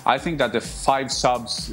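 normal talking voice

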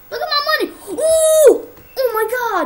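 loud, high cry of dismay; real surprise at losing money; shocked shout. Real, not acted. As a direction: yell at the shocking number.

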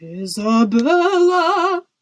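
long, drawn-out roar, high and very loud; thundering shout. A narrator's reading. King summoning his daughter from under the bed, thundering. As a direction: bellow the name slowly, letting it swell. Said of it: "thundered the king in such a loud voice".

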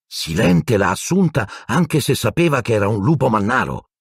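high-pitched, incredulous outburst; stunned exclamation. A narrator's reading. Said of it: "esclamò Ron sbalordito".

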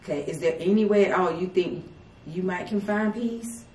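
usual speaking voice

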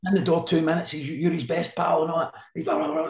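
retold high-pitched tirade; imitated roar. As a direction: rant like a boss losing it. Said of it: "he's roaring at me".

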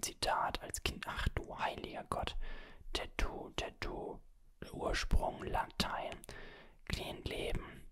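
ordinary delivery

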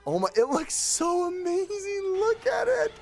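high, emotional squeal of wonder; thrilled high-pitched marvelling; awed excitement. Real, not acted. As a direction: gush in a high voice, overcome with delight. Marvelling at the shiny.

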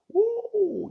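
high-pitched drawn-out whoop; light falsetto woo of surprise. Real, not acted. Pastor reacting to Saul's change of heart, high woo.

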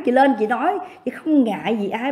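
normal talking voice

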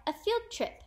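high-pitched delight; excited cry. A narrator's reading. Class thrilled about a field trip, excited cry. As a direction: pitch it up with glee. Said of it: "the class cried".

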